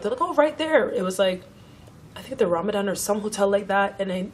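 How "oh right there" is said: imitated high, loud reply; chirpy answer. Passengers telling her where they're staying, mock-chirpy reply. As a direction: mimic a breezy answer in a raised pitch. Voices passengers pointing out their hotel.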